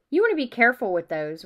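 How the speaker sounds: warning. A narrator's reading. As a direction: caution a friend emphatically. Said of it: "Ron warned Harry".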